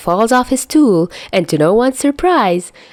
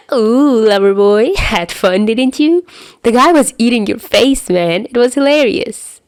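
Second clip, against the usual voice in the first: teasing; 4 semitones higher, 3 dB louder than usual. Acted. Albatross teasing Lip Man.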